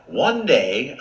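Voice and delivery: plain reading voice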